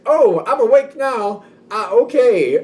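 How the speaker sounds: mock startled. Acting being jolted awake.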